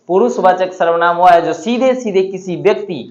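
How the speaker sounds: usual speaking voice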